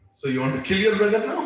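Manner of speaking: incredulous friend questioning. Retold or imitated. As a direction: ask in disbelief. Krishna checks Arjuna incredulously.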